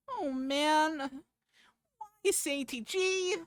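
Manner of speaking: high, whiny imp voice. Acted. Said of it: "good at the impy voice".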